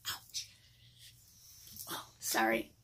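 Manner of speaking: whispered apology; softly whispered sorry. Real, not acted. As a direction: breathe it out under the voice. Hurt thumb, apologising.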